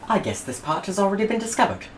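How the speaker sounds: high, fast, fussy English gentleman; a prim Englishman thinking aloud; George the Englishman. Acted. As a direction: chirp it out like a prim explorer. George voiced in story.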